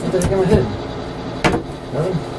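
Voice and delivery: ordinary talk, nothing marked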